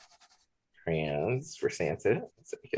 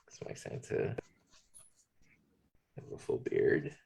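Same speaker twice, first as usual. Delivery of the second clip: whispered aside; 2 semitones lower, 6 dB quieter than usual. Real, not acted. Drawing Santa, soft aside.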